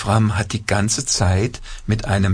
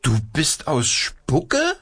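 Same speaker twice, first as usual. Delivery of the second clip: high, slow wondering puppet voice; astonished puppet; 7 semitones higher, slower than usual. A narrator's reading. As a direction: ask in wide-eyed wonder.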